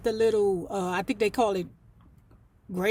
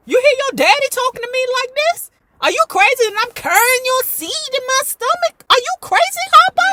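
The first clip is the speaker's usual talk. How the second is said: much higher, loud, climbing scream; shrieking Sophia; 13 semitones higher, 6 dB louder than usual. Retold or imitated. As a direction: scream at Harpo in outrage.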